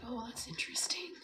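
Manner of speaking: hushed, mildly intrigued; whispered quote. Retold or imitated. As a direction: whisper it with quiet wonder. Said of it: "I was like".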